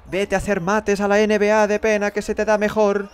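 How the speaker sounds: high, loud taunt at the player; loud jeering. Real, not acted. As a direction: heckle at full voice. Jeering the player who missed.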